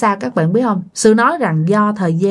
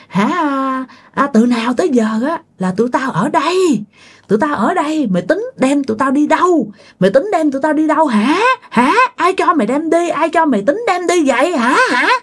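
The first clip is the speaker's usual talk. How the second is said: higher-pitched, quick-fire angry questioning; scolding ghost woman; 6 semitones higher than usual. Acted. Ghost pins down the sleeper, scolding in a raised pitch. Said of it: "vừa đè vừa chửi nha".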